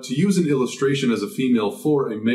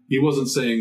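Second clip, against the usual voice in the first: ordinary talk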